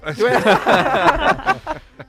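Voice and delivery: loud, high burst of laughter; real laughter. Real, not acted. Guest called handsome on radio, laughing loudly. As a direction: laugh hard at the compliment.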